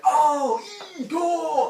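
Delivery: loud high cheer; crowd cheering. Retold or imitated. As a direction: whoop at the fight.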